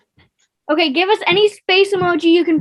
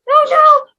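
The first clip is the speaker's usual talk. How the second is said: high, loud startled cry; alarmed; 12 semitones higher, 8 dB louder than usual. Real, not acted. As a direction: yelp as you slip. Slipping while climbing the silk.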